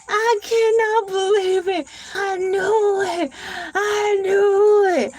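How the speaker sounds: high, crying wail, half hammed-up; sobbing mock disbelief, rising to a shout. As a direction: wail it like a fake tragedy. Mock despair opening the stream.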